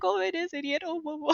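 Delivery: high-pitched, giggling mock shock; high giggly realisation voice; laughing mock surprise. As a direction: squeal it out through laughter. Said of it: "I was just like".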